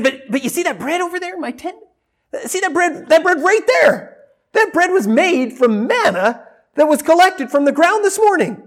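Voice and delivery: eager pointing out. Acted. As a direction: point at it like a proud host, voice up high.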